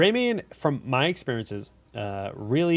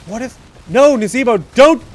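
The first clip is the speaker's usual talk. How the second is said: high, loud, horrified no; alarmed shout; 12 semitones higher, 16 dB louder than usual. Real, not acted. Caster pleading with Nazeebo on screen, high alarmed yell. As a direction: yell at the screen as disaster unfolds. Watching a teammate die in replay.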